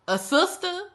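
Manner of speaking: can't believe the word; a sister, asked high and stunned; incredulous echo. Real, not acted.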